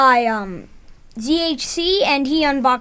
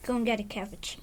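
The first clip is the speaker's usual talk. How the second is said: put-on soft, lower French-accented whisper; 5 semitones lower, 12 dB quieter, much faster than usual. Son closing out the video with gusto, French-accented whisper. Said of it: "My son's become French".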